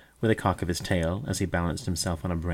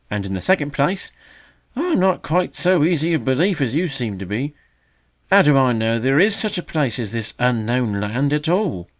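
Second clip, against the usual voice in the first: dismissive magpie; 5 semitones higher, 6 dB louder than usual. A narrator's reading. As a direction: wave it away with disdain.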